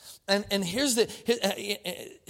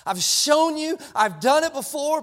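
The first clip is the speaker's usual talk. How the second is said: raised rebuke; 7 semitones higher, 5 dB louder than usual. Acted. Preacher voicing God, rising rebuke.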